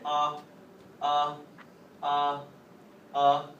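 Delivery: loud, drawn-out "ah" for the exam; saying ahh. Shown as a demonstration. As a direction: open wide and sing out "ah". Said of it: "I want you to say".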